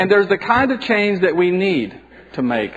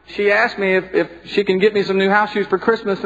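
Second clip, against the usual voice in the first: ordinary talk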